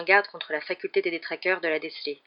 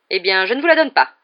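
firm, louder teacher's refusal; cutting refusal; 9 dB louder than usual. A narrator's reading. Teacher refuses her pupil the permission he begs for, cutting. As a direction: cut the request off decisively. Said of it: "trancha le professeur McGonagall".

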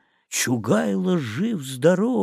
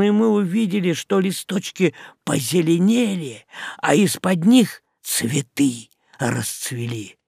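ordinary delivery

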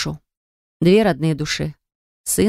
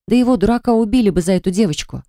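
usual speaking voice

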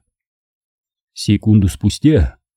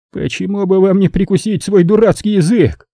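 belligerent growl; 9 semitones higher than usual. A narrator's reading. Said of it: "прорычал он воинственно".

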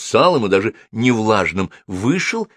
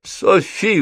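high-pitched imploring call; pleading; 12 semitones higher than usual. A narrator's reading. Wet wizard begs Sophie for help, pleading. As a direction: appeal for rescue, lightly whining. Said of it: "взмолился Холл".

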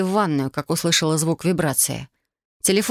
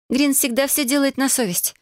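put-on bright girl voice; 9 semitones higher than usual. Ulyana's voice.

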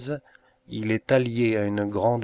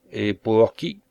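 plain reading voice

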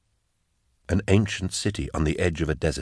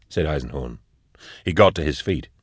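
ordinary delivery, nothing marked

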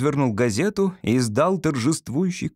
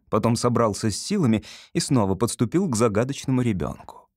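ordinary delivery

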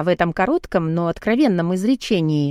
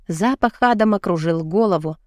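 usual speaking voice

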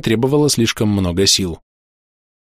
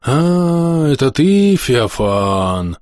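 sad sigh; 3 semitones higher, much slower than usual. Acted. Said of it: "грустно вздохнуло привидение".

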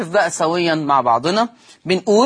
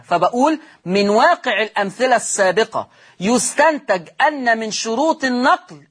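ordinary delivery

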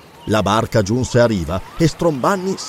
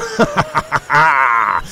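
big, drawn-out stage laugh; mocking laugh. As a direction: laugh scornfully at your rival. Pirate mocks his rival.

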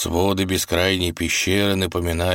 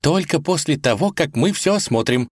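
firm declaration; 9 semitones higher than usual. Acted. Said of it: "заявила Василиса".

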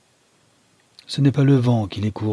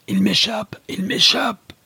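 much higher strained roar, breaking to a whisper; 13 semitones higher than usual. A narrator's reading. Captain losing his grip on Storitz, roaring high. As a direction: roar in despair as he slips away. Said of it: "rugit-il plutôt qu'il ne crie".

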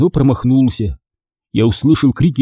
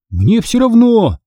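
high, slow, defiant exclamation; loud retort; 10 semitones higher, slower than usual. A narrator's reading. Pupil brushing off friend's warning, loud. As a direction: retort with pitch pushed right up. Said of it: "громко сказал я".

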